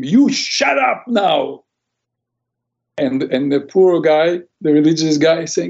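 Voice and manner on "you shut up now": mock angry scream. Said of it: "and screamed at him".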